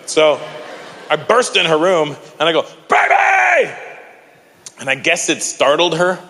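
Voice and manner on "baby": high, loud excited shout; excited yell. Retold or imitated. Dad bursting into his daughter's room, high excited yell. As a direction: burst in yelling to startle her.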